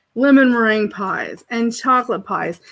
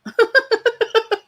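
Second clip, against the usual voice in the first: high real laugh; 12 semitones higher than usual. Real, not acted. Laughing after getting place wrong.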